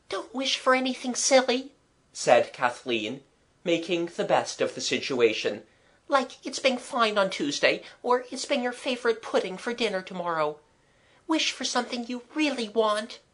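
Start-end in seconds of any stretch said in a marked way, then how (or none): 0.1-1.7 put-on higher-pitched girl's voice; Kathleen, sensible girl. A narrator's reading. Girl advising the bailiff on his wish, sensible. Child character's dialogue. 6.1-13.2 put-on high girl's voice, earnest advice; Kathleen, sensible girl. A narrator's reading. Girl advising the bailiff on his wish, sensible. Child character's dialogue.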